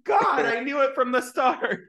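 high, loud, drawn-out yell; mock outraged shout. Viewer reacting to the killer reveal, mock outraged shout. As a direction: shout it through laughter, hammed up.

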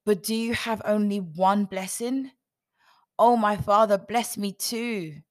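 loud, high, desperate begging; pleading. Acted. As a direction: plead hard, bursting out on the appeal. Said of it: "Esau pleaded".

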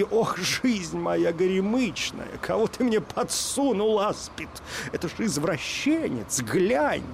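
high wailing plea, slow at the start; wailing lament. A narrator's reading. Young wife wails to the tsar over her husband. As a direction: wail to the tsar, wringing her hands. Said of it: "заламывая руки".

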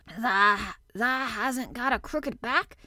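hesitant, drawn-out hoarse question; lightly voiced hoarse old man, halting. A narrator's reading. Old gardener doubting the boy's back, hoarsely. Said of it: "he said hoarsely".

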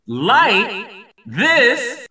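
very slow, swooping showman's call; drawn-out announcement. Pretend. Host announcing the ceremonial light-up to listeners, drawn out. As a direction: stretch each word, high and long.

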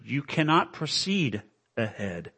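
ordinary talk, nothing marked

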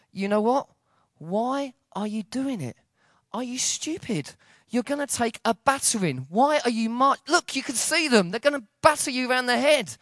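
high-pitched, incredulous pleading; exasperated disbelief. Retold or imitated. Onlooker pleading with marchers facing police, exasperated.

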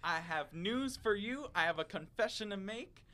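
high, loud, slow mock-solemn declamation; measured pundit proclamation, punching up each phrase; Stephen A. Smith. Pundit confessing to the turkey, high slow hammed-up declamation. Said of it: "what Stephen A quote would be your reaction".

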